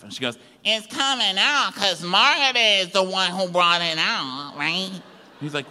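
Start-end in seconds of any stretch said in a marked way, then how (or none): 0.6-4.9 high, put-on argumentative voice; mock quarrelling woman. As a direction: answer back in a raised mimicking voice. Acted quarrel, woman answers Louis.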